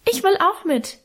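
put-on high piping little boy's voice. A narrator's reading. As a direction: pipe it up high like a small child. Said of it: "piepste unser kleiner Hermann". (kleiner Hermann piepst hoch, dass er auch mitwill)

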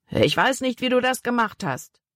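high, tense threat with restrained volume; threatening. A narrator's reading. Big man shakes his fist at the boy, threatening. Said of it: "drohte dem Jungen".

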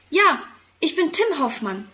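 usual speaking voice

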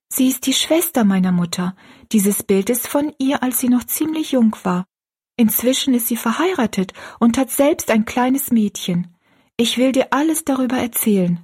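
put-on high girlish storytelling voice; young Rosalie, confiding. Rosalie tells her friend about her aunt, high and confiding.

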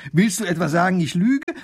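high, loud, offended demand; indignant and worked up. A narrator's reading. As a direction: bristle at being doubted. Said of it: "wird die Frau noch aufgeregter".

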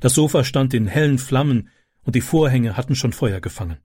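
normal talking voice

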